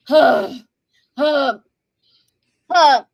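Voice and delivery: loud exaggerated sighs; imitating his fed-up sighing; mock heavy sighs. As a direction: huff out bored sighs.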